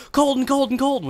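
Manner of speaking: very high mock cries of a frantic mother; imitated freak-out. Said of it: "She freaks out".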